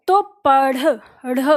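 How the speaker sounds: पढ़ and ढ़ said apart, breathy flap; isolated flapped ढ़. Shown as a demonstration. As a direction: isolate the ढ़ sound. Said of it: "जैसे हम कहते हैं पढ़ना". (ढ़ को अलग से बोलकर दिखाना)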